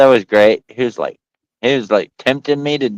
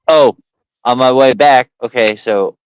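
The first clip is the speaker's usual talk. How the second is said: normal talking voice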